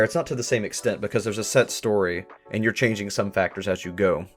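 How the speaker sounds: usual speaking voice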